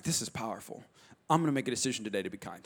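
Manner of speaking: low, soft murmur to oneself; privately moved, very soft and low; hushed private vow; quiet resolve. Retold or imitated. Quiet resolve while reading Bible alone.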